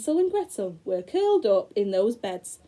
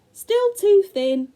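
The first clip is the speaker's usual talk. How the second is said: very high, loud, slow witch; witch's voice; 11 semitones higher, 8 dB louder, slower than usual. Acted. The witch feeling the bone, high, loud and slow. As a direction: screech it out slowly like a disappointed witch.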